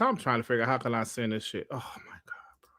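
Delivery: fast whispered muttering to himself. Real, not acted. Streamer fiddling with DMs, whispered to himself. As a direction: mutter it under the breath while working.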